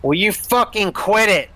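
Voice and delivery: loud, high-pitched irritated outburst; acted angry demand, pitched well up; exasperated demand. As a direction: cut them off, loud and fed up. Game master voices exasperated character's demand.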